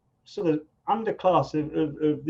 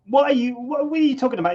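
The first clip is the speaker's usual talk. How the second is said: higher, louder mock indignant outburst; angry retort; 9 semitones higher, 5 dB louder, faster than usual. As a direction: imitate the boy snapping back, up high. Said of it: "He got really angry".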